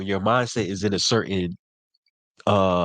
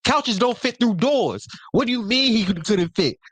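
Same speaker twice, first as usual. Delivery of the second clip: high, disbelieving outburst; hammed-up disbelief, pitched way up; incredulous; 11 semitones higher than usual. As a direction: boyfriend in the retold story, shocked and disbelieving. Boyfriend's disbelief retold.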